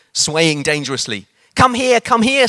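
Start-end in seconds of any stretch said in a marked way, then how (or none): 1.5-2.5 high, eager call; old lady beckoning the preacher, high and eager. A narrator's reading. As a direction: beckon him over eagerly. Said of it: "said her friend".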